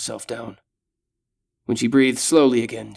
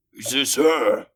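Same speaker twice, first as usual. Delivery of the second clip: yelling orc; slower than usual. Acted. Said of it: "the orc yells".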